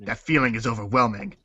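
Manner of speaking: usual speaking voice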